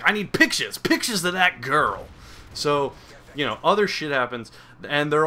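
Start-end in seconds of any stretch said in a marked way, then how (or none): 0.0-2.1 blustering editor, higher at first; J. Jonah Jameson. Retold or imitated. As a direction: demand it like an impatient newspaper boss. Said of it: "kick down their fucking door and be like".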